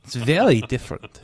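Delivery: mock German accent. Host correcting co-host about Gru, in a German accent. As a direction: argue the point in a comic German accent. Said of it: "he sounds just like you".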